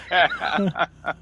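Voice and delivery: bursts out laughing; hearty laugh. Real, not acted. Laugh after the joke.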